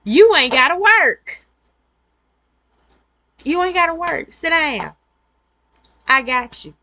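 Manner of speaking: high, loud insistent reassurance; reassuring partner. Retold or imitated. Partner telling her not to work, high and loud.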